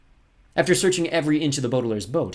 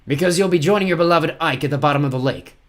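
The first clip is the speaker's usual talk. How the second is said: higher, louder threatening villain; menacing villain captain; 7 semitones higher, 9 dB louder than usual. Acted. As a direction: loom over your victim as you speak.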